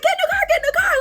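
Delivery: squeaky, very high mimic; mimicked urging. Rival's sister ordering her into the car, high mimicked urging. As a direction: imitate a pleading sister far above your own pitch.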